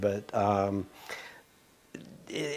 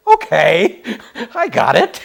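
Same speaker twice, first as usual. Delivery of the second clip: sheepish acceptance; 11 semitones higher, 8 dB louder than usual. Retold or imitated. Resigned acceptance of the reply.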